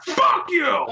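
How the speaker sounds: high, strained belligerent yell; mock yell. Homeless man yells at a little kid, high mock yell. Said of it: "yells down to this kid".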